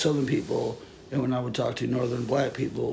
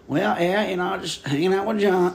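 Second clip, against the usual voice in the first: higher-pitched, slipped-into Southern twang; put-on Southern accent; 5 semitones higher, 3 dB louder than usual. Brother chatting casually with sister, higher Southern accent. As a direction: fall into a local Southern accent. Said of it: "even if I'm talking like my sister".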